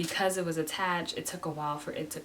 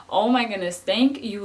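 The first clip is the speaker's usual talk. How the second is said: fervent thanks; 4 semitones higher, 8 dB louder than usual. Retold or imitated. Thanking God during procedure.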